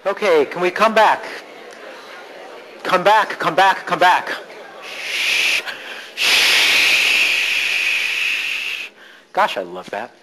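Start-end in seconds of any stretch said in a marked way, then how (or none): 0.1-4.3 raised, higher-pitched summons; calling out. Real, not acted. Coach regathering the group after an exercise, calling out. 4.8-8.9 unvoiced, sustained "shh". Real, not acted. Coach quieting the group, drawn-out shushing. Hushing the room.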